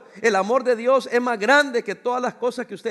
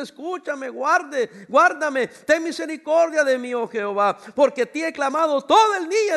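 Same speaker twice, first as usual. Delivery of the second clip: higher, louder anguished cry to God; broken plea; 5 semitones higher, 6 dB louder than usual. Acted. Preacher voicing broken David to God, anguished cry. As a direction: cry it out like a broken man begging. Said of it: "en su quebranto le dice".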